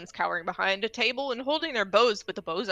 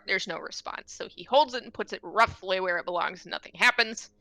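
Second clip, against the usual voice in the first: normal talking voice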